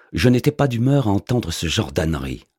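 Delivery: ordinary delivery, nothing marked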